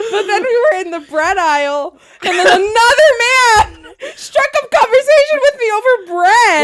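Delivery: high, loud laughing shout; incredulous laughing. Real, not acted. Wife recounting another flirting stranger, cracking up incredulously. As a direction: build to a shriek, cracking up.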